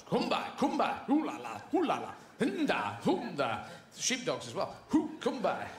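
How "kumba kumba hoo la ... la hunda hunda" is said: mock horse calls.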